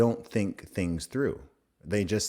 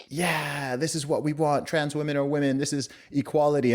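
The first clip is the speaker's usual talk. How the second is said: lightly voiced smug progressive, higher; a hint of a preachy activist; mock naive voice; 5 semitones higher than usual. Mimicking progressive women.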